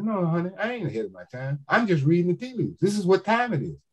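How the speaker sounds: high, fast, sassy no honey; mock diva put-down; sassy comeback. Shown as a demonstration. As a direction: brush it off with sass. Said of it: "you say".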